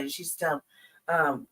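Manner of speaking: ordinary talk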